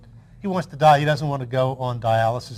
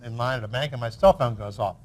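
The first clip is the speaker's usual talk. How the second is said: usual speaking voice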